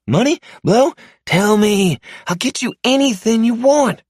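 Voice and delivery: high, panicked pleading voice, fast and loud; terrified captive man. Acted. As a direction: beg for your life in a frantic high voice. Mark bargains for his life.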